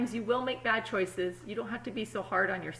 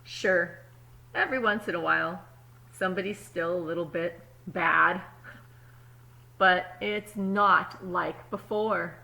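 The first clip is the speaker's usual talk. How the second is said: put-on calm storybook reading to kids; story narration; 5 dB louder than usual. A narrator's reading. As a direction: read it like a picture book at story time. Reads the story aloud.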